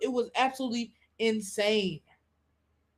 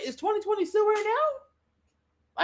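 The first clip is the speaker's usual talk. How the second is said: puzzled question; 11 semitones higher, slower than usual. Retold or imitated. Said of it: "sometimes I'll be like".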